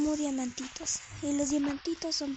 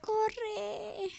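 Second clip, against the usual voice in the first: crying out; 7 semitones higher than usual. Real, not acted. Fleeing in game, cry heard.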